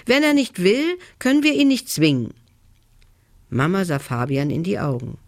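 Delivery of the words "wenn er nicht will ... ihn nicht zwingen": high, quickening indignant protest; upset. A narrator's reading. Son argues with his mother about his brother, upset. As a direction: argue back heatedly, pitched right up. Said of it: "fragte Fabian aufgebracht".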